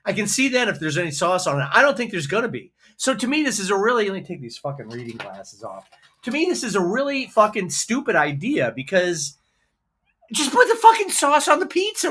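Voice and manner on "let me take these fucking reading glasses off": grumbled aside. Real, not acted. Aside while removing glasses.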